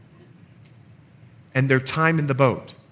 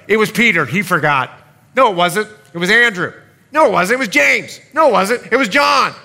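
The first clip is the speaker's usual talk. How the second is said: loud, higher squabbling voices tossing blame back and forth; bickering blame; 6 semitones higher, 8 dB louder than usual. Acted. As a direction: act out the disciples shouting at each other. Disciples blaming each other, acted quarrel.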